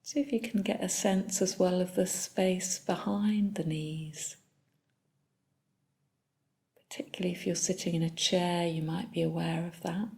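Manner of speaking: soft, unhurried body-scan guidance with long pauses; calm meditation leader, phrase by phrase; guided meditation. Real, not acted. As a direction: guide them gently through the body, leaving long silences.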